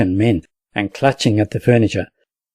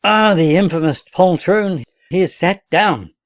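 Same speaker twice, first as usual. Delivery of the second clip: higher, disgusted cry; exasperated outburst; 7 semitones higher than usual. A narrator's reading. Captain seeing the drummer boy sit down, outraged. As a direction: spit out the insult in dismay.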